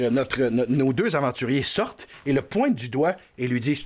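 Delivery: ordinary delivery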